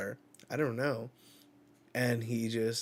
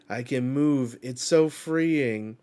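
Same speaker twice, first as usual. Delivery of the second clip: relieved delight; 2 semitones higher, 8 dB louder than usual. Acted. Loki relishing freedom.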